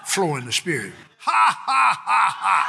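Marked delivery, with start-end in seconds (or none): 1.2-2.7 forceful, stepping-down "ha, ha, ha, ha"; deliberate laugh. Shown as a demonstration. Preacher flowing in the spirit, deliberate laugh. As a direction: hammer out each laugh. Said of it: "I'm laughing at the devil".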